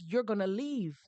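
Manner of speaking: ordinary talk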